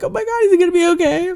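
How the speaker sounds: very high, racing mock panic; retold freak-out. Viewer fretting over the injured hero, retold freak-out. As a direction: blurt it out high and fast, imitating frantic worry. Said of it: "I'm, like, freaking out".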